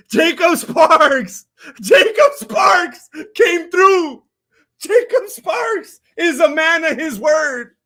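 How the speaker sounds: slow, loud, high cries of joy; real overjoyed yelling; ecstatic shout. Real, not acted. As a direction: scream the name in disbelief. Thrilled by a donor's payout.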